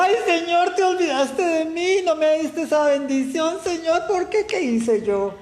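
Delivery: mock wail, pitched far up; hammed-up high self-pitying lament. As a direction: parody a spoiled believer whining at God after going broke. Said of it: "a la primera quiebra".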